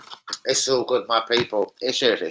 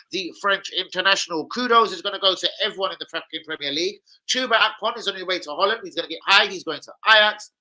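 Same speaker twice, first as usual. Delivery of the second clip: higher, punchy transfer-news read; news rundown; 9 semitones higher than usual. Acted. Vlogger reads the day's Arsenal transfer news to viewers in a host voice. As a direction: rattle through the headlines like a host.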